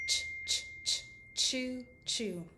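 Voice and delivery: "Ch, ch, ch, choo-choo" like a puffing train; choo-choo train sound. Shown as a demonstration. Therapist playing a train for a child. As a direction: puff out ch, ch, then a playful choo-choo. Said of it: "I call it the choo-choo sound, like in the train".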